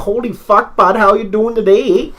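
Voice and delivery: high, slow, drawn-out mock Ontario bro; imitating a hearty Ontario guy; Cambridge Ontario bro. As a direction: stretch it out like a cheery Ontario bro. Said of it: "if you were in Cambridge".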